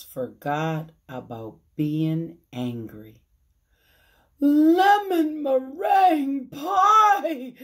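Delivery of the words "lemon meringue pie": high, loud, slow cry of delight; delighted exclamation. Acted. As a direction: exclaim it with joy. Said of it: "she exclaimed".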